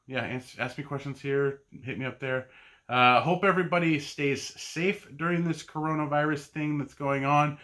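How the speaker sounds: usual speaking voice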